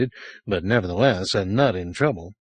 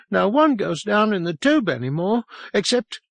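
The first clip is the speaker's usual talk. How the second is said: put-on higher, fearful child; shuddering boy; 11 semitones higher than usual. A narrator's reading. Frightened boy tells the sergeant about the tube, higher and fearful. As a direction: say it with a shudder of dread. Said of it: "he shuddered".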